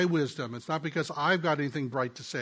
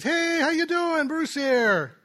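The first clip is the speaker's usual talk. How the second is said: high, louder and slow upbeat hello; calling a hurting student with a big cheerful hello; cheery phone greeting; 11 semitones higher, 5 dB louder, slower than usual. Retold or imitated. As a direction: greet him brightly to lift his spirits. Upbeat greeting to struggling friend.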